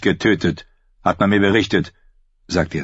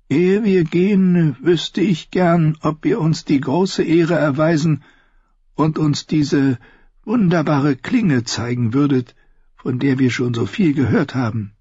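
put-on courteous old maester; 8 semitones higher than usual. Voices old Maester Aemon.

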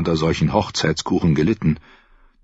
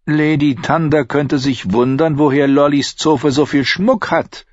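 angedeuteter Tyrion, vorsichtig einwendend; 8 semitones higher, 3 dB louder than usual. Acted. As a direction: bremst sie behutsam. Tyrion wendet ein. (Tyrion, leicht angedeutet)

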